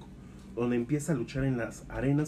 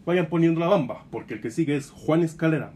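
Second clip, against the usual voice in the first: excited hype; 5 semitones higher, 6 dB louder than usual. Acted. Hyping the next wrestler's entrance.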